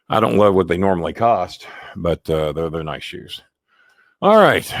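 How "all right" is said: out of breath. Real, not acted. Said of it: "I'm out of breath".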